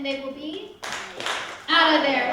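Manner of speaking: they will be, pause, OUT of there, punched loud; catchphrase with a pause. Shown as a demonstration. Host leads the audience in the elimination catchphrase. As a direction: drop the pause then hit OUT loudly. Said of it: "Let's all try that together".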